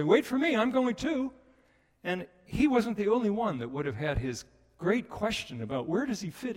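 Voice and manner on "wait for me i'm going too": high, eager calling out. Retold or imitated. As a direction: run after them calling. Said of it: "you hear other voices say".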